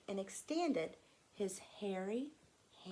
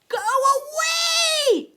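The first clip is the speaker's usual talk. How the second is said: put-on high, loud child's yell; angry shout; 16 dB louder, much faster than usual. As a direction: shout it to push someone away. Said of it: "Ann shouted".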